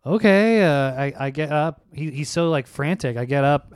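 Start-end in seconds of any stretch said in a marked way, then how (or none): 0.1-0.6 startled okay. Retold or imitated. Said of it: "I go".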